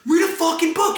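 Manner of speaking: high, strained shout from a passerby; imitating an angry stranger yelling; heckler's yell. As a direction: holler it high at someone down the street. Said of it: "I hear somebody yell".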